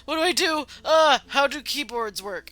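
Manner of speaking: high, panicky, clueless babble; flustered self. Retold or imitated. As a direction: play yourself totally lost at the keyboard. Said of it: "and I'm just like".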